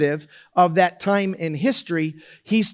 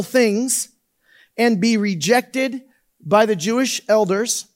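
scripture reading; 3 semitones higher than usual. Acted. Reads scripture aloud.

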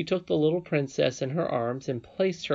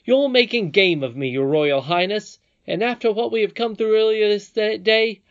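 loud, high, wounded reproach; hurt protest; 8 semitones higher, 8 dB louder than usual. A narrator's reading. Curdie accuses the princess of mocking him, loudly hurt. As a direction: protest as if badly hurt, voice raised. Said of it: "feeling very much hurt".

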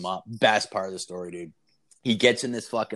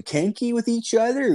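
squeaky high mocking take on a creepy guy; mock creepy question; 14 semitones higher, 4 dB louder than usual.

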